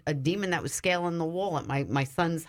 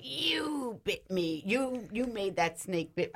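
very slow, soft and higher, eerie; possessed woman; 4 semitones higher, 4 dB quieter, slower than usual. Retold or imitated. Possessed woman accusing the speaker, slow and eerie. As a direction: drag each word out like something else speaks. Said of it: "And she's like".

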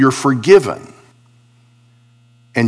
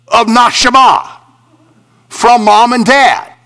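raised pitch, driving sermon delivery; emphatic preaching; 6 semitones higher, 4 dB louder than usual. Real, not acted. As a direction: preach it in punched, separated phrases. Preaching builds to full force.

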